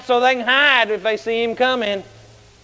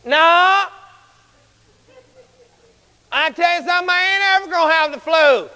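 high, emphatic retort; bold refusal; 7 semitones higher than usual. Retold or imitated. Preacher refusing to fear the flu, bold and high. Bold answer to the hushed question.

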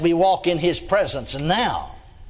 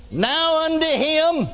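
high, slow, drawn-out scripture intoning; preaching; 8 semitones higher, slower than usual. Acted. Scripture read in preaching cadence.

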